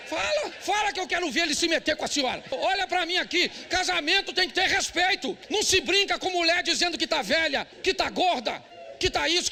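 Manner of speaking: loud, high fervent preaching; preaching at full force. Acted. Pastor scolds husbands in church, loud preaching voice. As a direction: rebuke the husbands from the pulpit.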